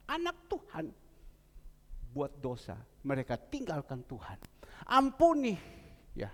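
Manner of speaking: normal talking voice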